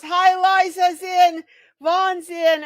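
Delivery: excited shout of welcome. Real, not acted. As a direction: call out a happy greeting.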